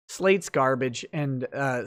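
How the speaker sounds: ordinary talk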